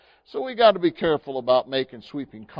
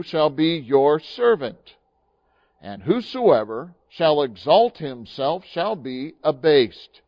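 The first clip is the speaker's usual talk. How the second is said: scripture reading; 4 dB louder than usual. Acted. Pastor reads the Bible passage to the congregation, solemn and measured. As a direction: read the verses out with weight.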